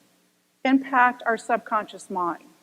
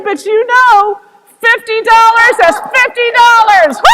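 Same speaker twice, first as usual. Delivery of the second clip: much higher and louder mock shout; shouted punchline; 10 semitones higher, 14 dB louder than usual. Fred insisting to the pilot, mock shout. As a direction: bellow it out.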